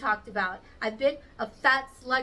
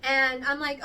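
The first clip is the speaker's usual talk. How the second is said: ordinary talk, nothing marked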